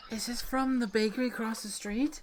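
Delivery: put-on high, breathless kid's wonder; excited gasp. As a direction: gasp and ask in delight. Kid gasps at cupcake gift.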